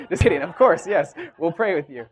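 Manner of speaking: loud, high, laughing reassurance. Real, not acted. Joking with listeners about refusing prayer, laughing. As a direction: laugh it off as a joke.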